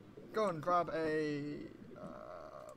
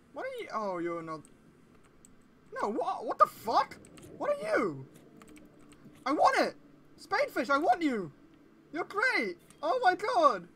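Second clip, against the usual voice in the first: much higher and louder, gushing delight; excited squeal; 12 semitones higher, 8 dB louder than usual. Real, not acted. Player spots a spadefish, high excited squealing. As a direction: squeal at the cute fish.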